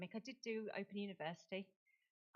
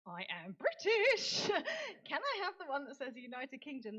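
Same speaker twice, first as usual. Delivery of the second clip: insisting to the UN staff she is British; indignant insistence; 7 semitones higher, 8 dB louder than usual. Retold or imitated.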